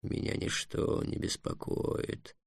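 very soft, slow, whispery murmur; hushed mantra, barely voiced; muttering dragon. A narrator's reading. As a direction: soothe yourself in a slow hush. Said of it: "бормотал дракон".

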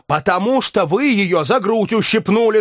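high, heated yell; shouting. A narrator's reading. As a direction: cut in with an accusing shout. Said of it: "закричал Барменталь".

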